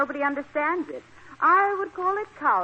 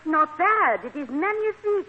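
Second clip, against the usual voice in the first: higher, gushing fake French accent; phony French accent; 6 semitones higher than usual. French guest praises the room to the landlady, phony French accent. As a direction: gush in a put-on French accent. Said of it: "My phony French accent".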